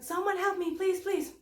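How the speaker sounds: high, desperate calling out; begging passing cars to stop; pleading for help. Retold or imitated. Stranded on the highway, pleading to passing cars for help. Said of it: "I was like".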